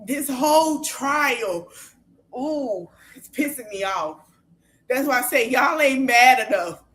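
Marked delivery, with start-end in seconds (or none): 4.9-6.8 real anger. Real, not acted. Scolding her viewers for not being angry enough, real loud anger. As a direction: chew them out for not caring enough. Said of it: "I'm so mad".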